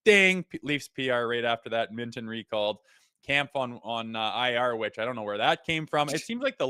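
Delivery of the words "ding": bright high ding, called out loud; imitated notification ding. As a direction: ring it out like a phone alert. Phone alert right after chat.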